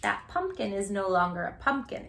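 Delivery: plain reading voice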